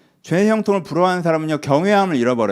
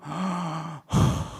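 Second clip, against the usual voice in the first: heavy huff of acted anger; big fuming breath; exasperated gasp; 2 semitones higher, 5 dB quieter than usual. Envious person seeing the wicked prosper, huffing angrily. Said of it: "악인의 형통을 보면 막".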